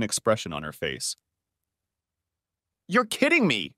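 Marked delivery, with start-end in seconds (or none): 2.9-3.7 high, astonished exclamation; gasped disbelief. A narrator's reading. Man hears the neighbors' rumors, gasping in disbelief. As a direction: jump up high in shock, then drop. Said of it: "I gasped".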